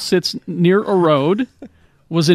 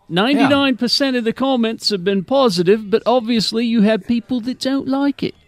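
acted quote; 6 semitones higher than usual. Reading a quoted man's words.

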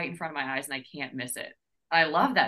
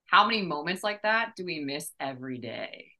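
ordinary talk, nothing marked

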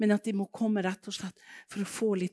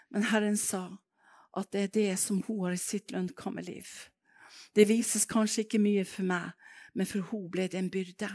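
usual speaking voice

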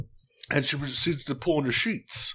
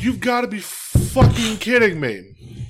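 disbelief; 8 semitones higher, 5 dB louder, slower than usual. Retold or imitated. Retelling disbelief at date.

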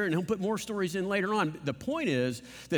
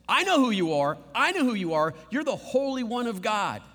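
louder, higher demon cry; 4 semitones higher, 4 dB louder than usual. Acted. Preacher voicing the demon crying out at Jesus. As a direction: shout it like a cornered demon.

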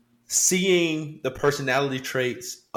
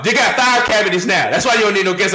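louder and higher, hammed-up dad lecture; mock scolding; 7 semitones higher, 7 dB louder than usual. A father telling off his children, loudly and higher. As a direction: scold them like an exasperated parent.

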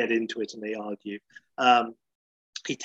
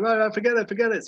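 higher, exasperated whine; Larry David impression; 9 semitones higher, faster than usual. Larry backing out of the motel, in a higher exasperated whine. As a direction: give up like a flustered nebbish. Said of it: "so Larry's like".

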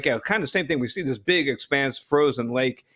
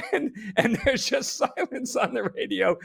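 laughing; 11 semitones higher than usual. Real, not acted. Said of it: "laugh heard on this line".

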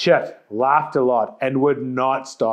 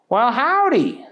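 country bumpkin greeting; 10 semitones higher, 3 dB louder than usual. A narrator's reading. Booger greets Jean-Pierre in a high country voice. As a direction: chirp it like a country boy.

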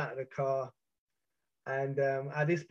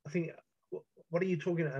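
imitated flustered stutter, restarting words; retold stuttering; 2 semitones higher, much faster than usual. Suspect answers the man screaming at him, imitating his own stutter. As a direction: stammer over the first words, then rush the question. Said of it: "I'm stuttering like".

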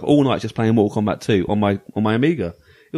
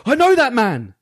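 high, louder retold outburst of recognition; acted astonished recognition; 10 semitones higher, 4 dB louder, slower than usual. Podcaster retelling spotting an old school friend, astonished. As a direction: blurt it out high, imitating your startled self.

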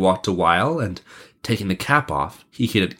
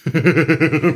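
higher, mocking chuckle; scornful laugh; 7 semitones higher, 4 dB louder than usual. Gang leader hearing the prince's offer, scornful laugh. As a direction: laugh derisively in character. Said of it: "exclaimed the leader scornfully".

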